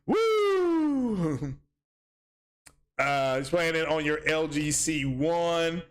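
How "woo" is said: excited cheer. Real, not acted. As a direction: let out a big happy woo. Cheering at donation.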